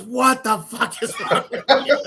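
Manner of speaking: high, loud quote breaking into laughter; laughing mock outrage. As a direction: imitate an incredulous wife while cracking up. Retells wife's reaction, laughing.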